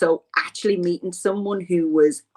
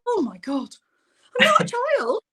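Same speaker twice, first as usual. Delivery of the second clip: mock indignant exclamation. Her own retold protest.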